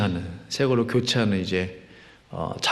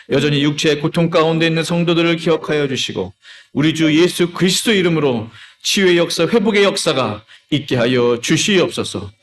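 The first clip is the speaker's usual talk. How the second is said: insistent, raised, chant-like petitions; fervent intercessory prayer; 5 semitones higher, 5 dB louder than usual. Real, not acted. Pastor leading the congregation in intercession, fervent prayer. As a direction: plead steadily, rising into each request.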